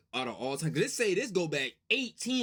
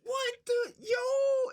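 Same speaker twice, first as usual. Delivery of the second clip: very high, drawn-out astonishment; amazed disbelief; 12 semitones higher, 3 dB louder, much slower than usual. Real, not acted. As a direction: stretch it out in stunned falsetto. Amazed at how old rivalry is.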